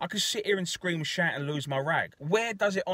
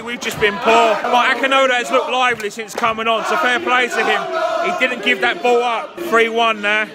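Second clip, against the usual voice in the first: ecstatic, much higher and louder yelling; goal celebration; 9 semitones higher, 8 dB louder than usual. Real, not acted. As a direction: scream with joy at the goal.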